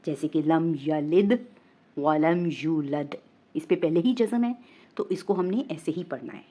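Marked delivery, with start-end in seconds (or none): 0.4-3.2 slow recitation, यलिद and यूलद closed on d; unchanged jazm ending. Shown as a demonstration. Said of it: "जज़म वाला हरफ है तो वो ऐसे ही रहेगा". (यलिद, यूलद को साकिन दाल पर रोकें)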